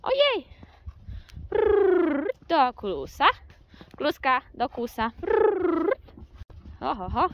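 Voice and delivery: high, loud rolled brrr commands to the horse; calling commands. Real, not acted. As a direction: call the horse down, ringing out. (głośne komendy do konia)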